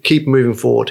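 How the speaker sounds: normal talking voice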